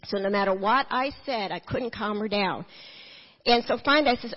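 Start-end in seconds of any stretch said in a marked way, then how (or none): none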